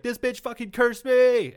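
high, slow, whiny outraged complaint. Retold or imitated. Man reporting curse to town.

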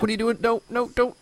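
high-pitched, pleading protest; acted-out upset, begging them to stop; retold dismay. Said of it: "It was sad. I was like".

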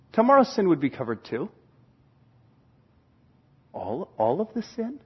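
trailing down to a near whisper in wonder; hushed disbelief. Acted. Believer asking the preacher if all sin is covered, hushed disbelief. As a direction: ask it softly, awed and hesitant.

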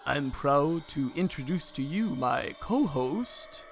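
slow, eerie announcer from beyond; spooky host voice. Acted. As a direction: drawl it like a ghostly host. Host intro as spooky disembodied voice.

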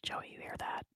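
barely audible acted whisper. Asking Joey about footsteps in the dark, whispered. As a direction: whisper it secretly to a companion.

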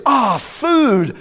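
a hungry shark spotting its meal; hammed-up hungry shark. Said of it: "and the shark sees".

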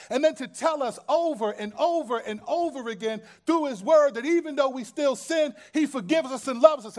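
loud, high shouted sermon; full-force preaching. Acted. Preacher shouts to the congregation about God's grace, full force. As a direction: shout the good news to the rafters.

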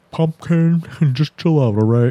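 lightly imitated mild dad, slightly put-on; half-hearted fatherly pacifying, with a chuckle; passive dad. Dad to daughter in the car, lightly imitated pacifying. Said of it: "the dad's like".

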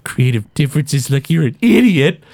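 mock-indignant professor insult; acted bickering retort; arguing. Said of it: "they both start arguing".

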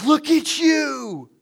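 gasp, then high, loud drawn-out squeal; hammed-up adoring shriek at the bride; gushing awe. Wedding guest fawning over Bill's bride, gushing awe.

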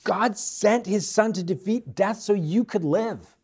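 ordinary delivery

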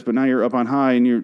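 ordinary talk

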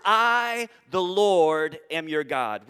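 I and Lord spaced with pauses and punched loud; proclaimed slowly. Shown as a demonstration. Preacher proclaiming God's name to the congregation, loud and deliberate. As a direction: declare I, the Lord, each phrase landing heavy. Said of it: "He starts out with these words".

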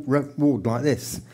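ordinary delivery

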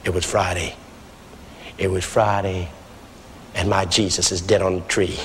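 very low, hushed preacher; nice and soft. Retold or imitated. Old pastor begins his one-line sermon, nice and soft. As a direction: open the sermon gently, deep and solemn. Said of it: "Started nice and soft".

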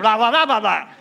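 loud, hammed-up blah blah; mock blah blah. Pastor echoing Tim's joke, loud mock babble. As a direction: blurt the blahs out like noise. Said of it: "Tim said".

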